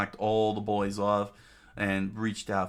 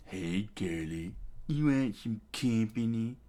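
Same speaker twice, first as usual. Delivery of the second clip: creepy bandit. Acted. Bandit accosts the young girl.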